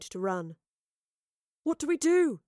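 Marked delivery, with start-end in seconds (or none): 1.6-2.4 high-pitched, anxious question; panicked cry. A narrator's reading. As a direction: cry out for help in alarm. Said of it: "cried Gaius".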